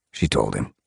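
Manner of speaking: usual speaking voice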